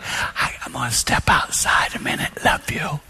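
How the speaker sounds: whispered calm exit line. Retold or imitated. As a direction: step away with a whispered goodbye. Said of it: "Soft voice".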